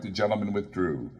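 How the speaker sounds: plain reading voice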